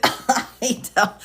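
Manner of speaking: usual speaking voice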